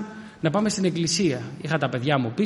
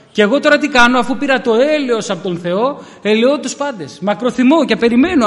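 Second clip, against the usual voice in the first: loud, higher preaching swell; loud, higher sermon climax; preaching at full force; 5 semitones higher, 5 dB louder than usual. Real, not acted. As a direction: lift the congregation with rising fervour.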